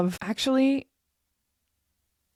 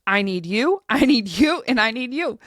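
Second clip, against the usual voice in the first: louder and rising, higher on the last two lines; earnest plea, louder and higher; heartfelt admission; 4 semitones higher, 6 dB louder than usual. Real, not acted. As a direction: open up and admit you need them. Realizing she needs help.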